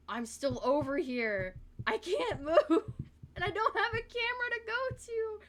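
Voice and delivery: loud, rising to a very high laughing wail; exasperated squealing. Real, not acted. Streamer stuck in Blender, exasperated laughing squeal.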